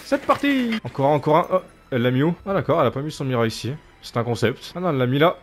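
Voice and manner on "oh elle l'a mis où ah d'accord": normal talking voice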